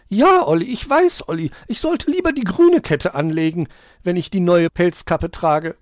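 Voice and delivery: high, loud, gabbling creature voice; loud chattering. A narrator's reading. Tree dweller chatters to Olli about chain and cap, gabbling. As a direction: chatter away shrilly at top pitch. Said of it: "hörten es laut schnattern".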